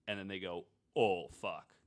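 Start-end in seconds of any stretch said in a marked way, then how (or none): none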